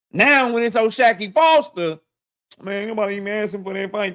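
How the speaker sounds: normal talking voice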